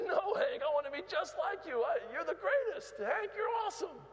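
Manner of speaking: gushing fan. Retold or imitated. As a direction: gush at Hank like an overexcited fan. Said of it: "the man said".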